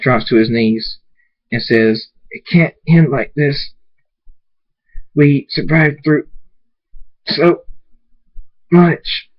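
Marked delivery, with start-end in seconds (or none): 2.3-9.3 despairing plea. A narrator's reading. Character despairs on his knees.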